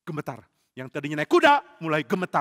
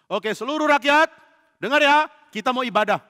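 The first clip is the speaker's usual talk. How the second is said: calling out; 6 semitones higher, 4 dB louder than usual. Acted. Calling the people to worship.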